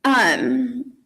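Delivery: usual speaking voice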